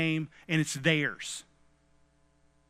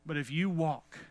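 normal talking voice

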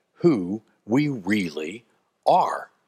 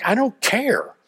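acted defiance; 8 semitones higher than usual. David defies warnings about the giant.

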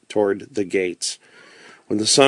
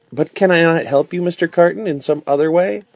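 higher-pitched, pleading character voice; raised pitch, an earnest gentle question; concerned Miss Manette; 7 semitones higher than usual. Acted. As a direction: lightly voice a lady offering help.

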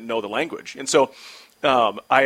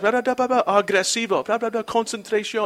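mock Spanish pep talk; 8 semitones higher than usual. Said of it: "I picked out a few words".

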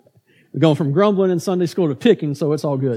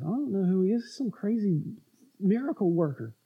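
grumbling mutter; 8 dB quieter than usual. Retold or imitated. Crowd grumbling about Jesus.

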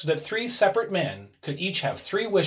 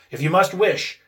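gruffly; 4 semitones higher, 8 dB louder than usual. A narrator's reading. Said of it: "he said gruffly".

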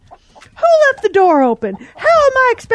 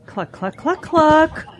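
sulky clucks; 3 semitones lower, 4 dB quieter, slower than usual. Acted. Hen clucking sulkily.

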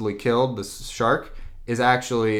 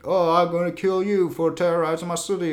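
high, mock-heroic threat; staged-fight threat; 7 semitones higher than usual. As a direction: declaim it like a fake hero. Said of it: "this huge staged fight".

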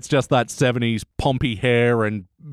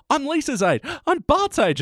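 much higher, bright excited imitation of younger self; mock childish excitement; 14 semitones higher than usual. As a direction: squeal it like a thrilled kid. Retelling younger self's excitement.

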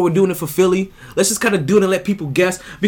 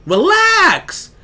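laid-back high reassurance; 8 semitones higher, 5 dB louder, much slower than usual. Retold or imitated.

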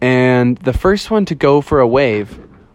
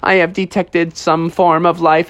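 higher, even robot monotone; robot bear; 4 semitones higher than usual. Acted. Techno Bear reporting signs of life, even robotic voice. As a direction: repeat it mechanically, word by word.

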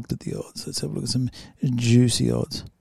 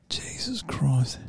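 awed whisper; 8 dB quieter, slower than usual. Real, not acted. Amazed by fighter's record.

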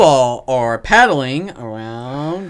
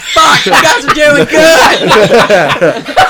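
high, loud shout through laughter; laughing shout; 12 semitones higher, 7 dB louder, much slower than usual. Acted. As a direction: yell it while cracking up.

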